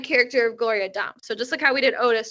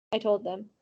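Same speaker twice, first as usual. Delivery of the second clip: usual speaking voice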